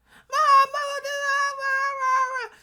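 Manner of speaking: put-on high, slow, loud spoiled-kid whine; mock little kid begging loudly; whiny child. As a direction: whine like a bratty toddler demanding a toy.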